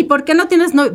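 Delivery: high-pitched mock nosy question; nosy prying question. Woman mimicking relatives who pry about her love life, high and nosy. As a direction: ask it like a meddling aunt.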